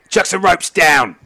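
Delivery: loud, high captain's command; shouted order. Acted. Captain ordering his crew to help, shouting. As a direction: yell it down the deck to your crew.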